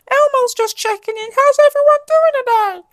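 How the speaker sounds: chirpy high Elmo voice checking in; bright high-pitched Muppet voice; Elmo impression. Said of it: "That's not a good impression".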